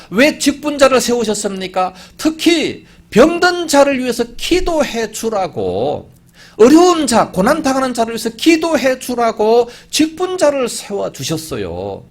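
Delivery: high preacher's voice, drawn-out stressed phrases; emphatic preaching. Acted. Preacher reminds church officers to pray for the sick, emphatic preaching. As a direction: drive each phrase home from the pulpit.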